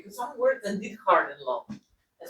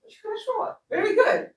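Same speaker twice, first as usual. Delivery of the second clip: excited praise; 12 semitones higher, 4 dB louder than usual. Real, not acted. Teacher praising learner's answer.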